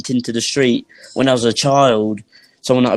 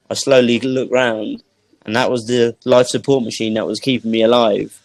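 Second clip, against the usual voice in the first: ordinary delivery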